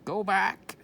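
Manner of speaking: high, loud parrot squawk. A narrator's reading. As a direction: screech it like a parrot. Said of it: "squawked Polly".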